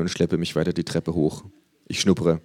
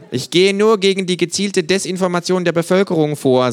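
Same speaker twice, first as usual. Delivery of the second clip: much higher, louder eager kangaroo; 13 semitones higher, 7 dB louder than usual. Acted. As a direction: sell it like a gadget nerd. Känguru voiced by narrator.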